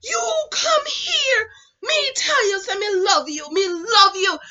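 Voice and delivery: put-on high, loud, indignant Jamaican accent; imitated Jamaican boy. Jealous boy confronting her, high indignant Jamaican accent. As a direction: protest shrilly like a jilted Jamaican lad. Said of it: "this how they talk".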